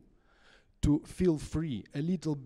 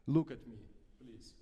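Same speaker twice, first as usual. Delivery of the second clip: normal talking voice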